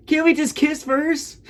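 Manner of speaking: tiny, very high falsetto plea; Butters impression. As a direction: imitate a timid cartoon character in squeaky falsetto. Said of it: "Butters goes".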